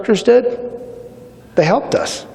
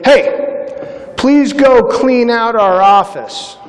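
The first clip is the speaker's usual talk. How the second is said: instructor's order; 7 semitones higher, much slower than usual. Retold or imitated. Instructors' request quoted.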